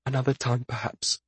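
soft whisper, fading away; hushed, trailing reply. A narrator's reading. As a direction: whisper it as a quiet deferral. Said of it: "he whispered".

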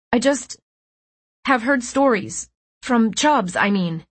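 nervous. A narrator's reading. Flustered lie after flinch.

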